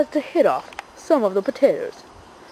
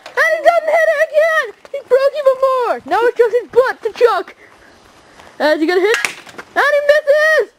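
much higher, fast breathless commentary; excited play-by-play; 13 semitones higher than usual. Acted. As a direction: call every hit like a sportscaster. Live commentary on toy stunts.

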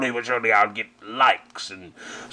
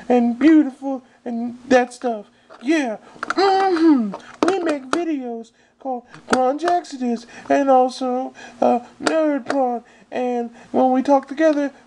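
put-on much higher, bubbly valley girl voice; girly voice. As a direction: play a ditzy girl, pitched way up. Stephanie character continues.

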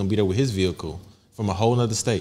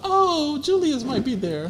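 high swooping mock-girly voice; high, slow sing-song imitation of her; 14 semitones higher, much slower than usual. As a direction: tease her with a hammed-up lovestruck sigh. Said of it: "you be like".

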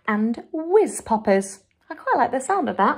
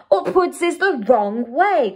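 higher, slow, emphatic giant's protest; indignant cry; 4 semitones higher, 4 dB louder than usual. A narrator's reading. Giant corrects Sophie about fizzy drinks, slow indignant cry. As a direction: cry it out, stretching the words. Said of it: "cried the B F G".

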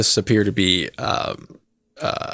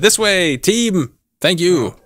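Zatasha calling the team; 8 semitones higher, 4 dB louder, much slower than usual. Acted. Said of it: "So Zatasha just said".

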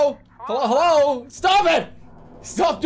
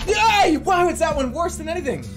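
high excited yelling; excited shout; 14 semitones higher, 10 dB louder than usual. Real, not acted. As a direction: cheer it loud.

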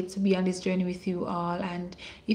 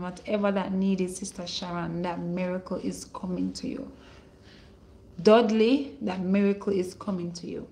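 ordinary talk

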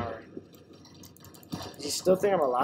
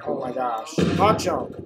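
ordinary delivery

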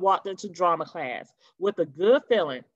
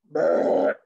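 burp sound; 5 semitones lower, 4 dB louder than usual. Acted.

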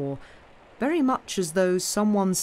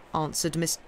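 usual speaking voice

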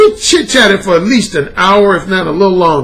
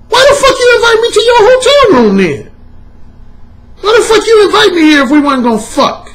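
high, louder indignant rant; mock furious outburst at her; pissed off; 13 semitones higher, 3 dB louder than usual. Retelling his radio rant, high and angry. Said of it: "I got pissed".

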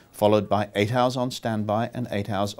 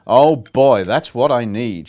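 relieved delight; 10 dB louder than usual. A narrator's reading. Daisy grateful for tea.

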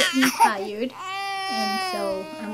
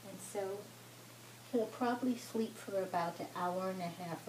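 soft, lowered voice beside a sleeper; hushed; 4 semitones lower, 13 dB quieter than usual. Real, not acted. Mother reports her boy is asleep, hushed. Toddler has just fallen asleep.

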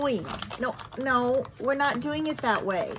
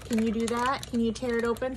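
ordinary delivery

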